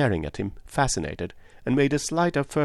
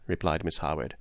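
usual speaking voice

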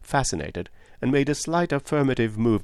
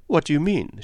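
higher, sharply put question; 7 semitones higher, 3 dB louder than usual. A narrator's reading. Woman challenges the detective's question, sharply. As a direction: demand an explanation. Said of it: "she asked sharply".

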